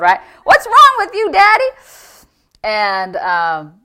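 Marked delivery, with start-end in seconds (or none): 0.4-1.7 very high, loud mock teenage dismay; sixteen-year-old daughter. Daughter confronts her wild father, in mock high-pitched alarm. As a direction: imitate an appalled teenage girl. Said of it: "can you imagine a sixteen-year-old".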